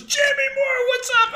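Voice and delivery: very high, exuberant greeting impression; Larry David. Accountant greeting a familiar client, high Larry David impression. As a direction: squeal the greeting in comic impersonation. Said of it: "just like in Larry David's voice".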